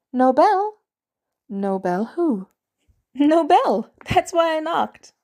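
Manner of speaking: put-on a proud kid landing his joke; boyish joke teller. Boy telling Natalia a knock-knock joke, pleased.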